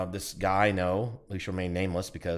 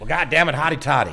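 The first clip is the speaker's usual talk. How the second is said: loud, higher whoop of hotty toddy; mock rallying cry; 8 semitones higher, 10 dB louder than usual. Cohost joking about Mississippi, loud mock cheer. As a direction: bellow it like a game-day fan. Cheering the Ole Miss call.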